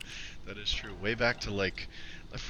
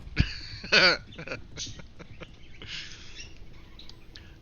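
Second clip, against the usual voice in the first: high, loud laugh trailing off; real breathy laughter; amused laugh; 9 semitones higher, 5 dB louder than usual. Real, not acted. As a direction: burst out laughing, then wheeze. Laughing with the chat.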